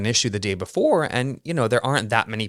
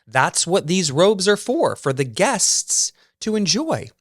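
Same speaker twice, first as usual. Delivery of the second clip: much higher, sweetly accommodating service voice; gracious worker; 9 semitones higher, slower than usual. Retold or imitated. Maintenance worker graciously hands over a robe, higher and sweetly obliging. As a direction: play the eager, gracious attendant. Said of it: "he so graciously offered me one".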